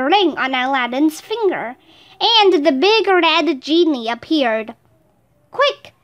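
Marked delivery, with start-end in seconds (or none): 5.5-5.9 urgent cry. A narrator's reading. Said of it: "Aladdin cried".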